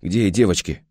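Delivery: plain reading voice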